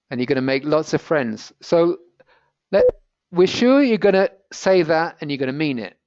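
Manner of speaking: ordinary talk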